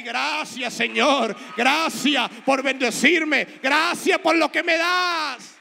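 huge, slow shouted "gracias, Señor"; shouted thanks. Shown as a demonstration. Preacher showing the church how to thank God, shouting. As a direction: cry out your thanks with all your strength. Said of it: "recibe los regalos y di".

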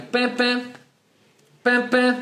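high, short honking beeps; imitated car horn. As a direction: beep like a little car horn. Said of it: "começo a dar buzinadinha". (imitando buzina de carro)

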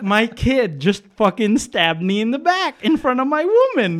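high, loud comic indignation; worked-up retold rant; mock betrayed outrage. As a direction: protest like a publicly humiliated dad. Acted complaint of betrayal.

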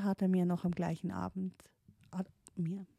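ordinary delivery

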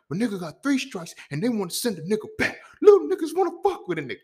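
high-pitched, distraught movie-character wail; Melvin from Baby Boy. Acted. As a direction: do a comic impression of an aggrieved man. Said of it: "dude on Baby Boy, Melvin".

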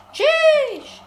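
high, loud farewell shout; gleeful yell. Real, not acted. Gamer yelling goodbye while jumping off. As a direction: yell goodbye as you leap.

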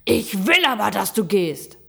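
furious shout. A narrator's reading. Gram insists the animal must go, shouting. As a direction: shout the demand. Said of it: "schrie er".

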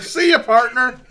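high, loud cowboy goodbye; a mock-cowboy "See ya, partner"; cowboy farewell. Friends parting after mushrooms, said as a hammed-up cowboy farewell. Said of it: "They're just like".

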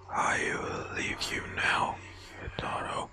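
soft, slow spectral whisper; ghostly whisper. Acted. Ghost saying farewell to the boy, soft spectral whisper. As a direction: breathe it like a fading ghost.

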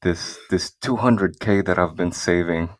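ordinary talk, nothing marked

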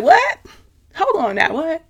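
high incredulous "what?"; a sharp double take, voice jumping up; startled disbelief. Retold or imitated. Caught off guard by God's words, high startled disbelief. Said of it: "And I was like".

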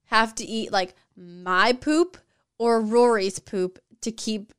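drawn out slowly, half-teasing; a slow, hammed-up quiz question; slow mock question. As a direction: slowly pose a gross dilemma. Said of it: "you'll be like".

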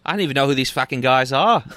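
mock Aussie accent; Australian listener. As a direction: play a baffled local with an Australian accent. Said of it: "the three people that listen to the podcast in Australia".

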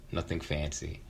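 usual speaking voice

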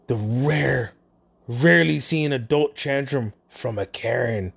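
ordinary talk, nothing marked

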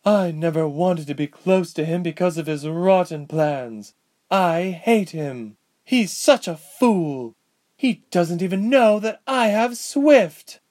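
slow, hateful words building to a shout; building to a cry. Acted. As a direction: start bitter, end crying out. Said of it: "she cried out".

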